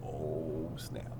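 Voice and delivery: ordinary talk, nothing marked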